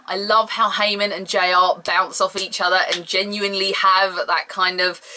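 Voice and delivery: ordinary delivery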